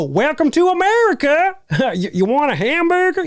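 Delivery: very high, hammed-up American border guard; cartoon American accent. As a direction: parody an American accent with a laugh. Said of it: "it would be like a Canadian cartoon".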